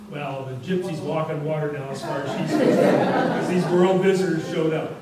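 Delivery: real amused laugh while talking. Real, not acted. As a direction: tell it as a punchline, breaking into laughter.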